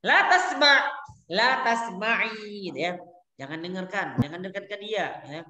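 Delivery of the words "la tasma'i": La tasma'i drawn out very slowly with the final i held; slow model form. Shown as a demonstration. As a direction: stretch La tasma'i slowly for the class. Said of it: "teacher models feminine form". (La tasma'i diucapkan sangat pelan)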